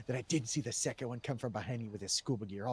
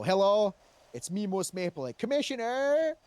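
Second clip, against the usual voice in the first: mock official greeting; 8 semitones higher, 7 dB louder, slower than usual. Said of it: "I go".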